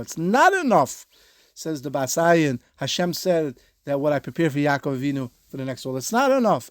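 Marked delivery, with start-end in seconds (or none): 0.0-1.1 'not' punched high and loud; stress on NOT. Shown as a demonstration. The Bas Ayin's reading pressed on students, loud 'not'. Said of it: "It, it depends where you put the emphasis". 6.0-6.7 'not' punched high and loud; stress on NOT. Shown as a demonstration. The Bas Ayin's reading pressed on students, loud 'not'. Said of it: "It, it depends where you put the emphasis".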